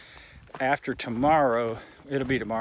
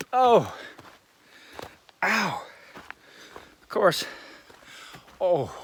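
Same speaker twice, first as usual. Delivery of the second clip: stubbed toe; 6 semitones higher, 3 dB louder, slower than usual. Real, not acted. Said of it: "Stubbed my toe on a rock".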